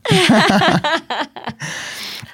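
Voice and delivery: long, high-pitched burst of laughter. Real, not acted. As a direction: laugh heartily in a long run that falls away. Se ríe tras su comentario. (carcajada larga y aguda)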